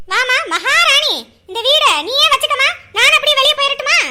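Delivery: mock her as a queen, loud; sarcastic. Mother-in-law mocking daughter-in-law as a queen, loud sarcasm.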